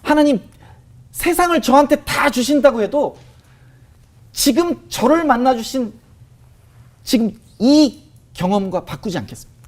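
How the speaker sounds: a slow heartfelt confession; sincere confession. Retold or imitated. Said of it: "이렇게 저도 모르게 고백을 하게 됐어요". (높고 느리게, 진심 어린 고백)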